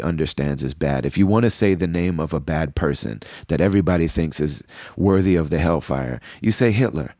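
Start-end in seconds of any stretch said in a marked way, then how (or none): none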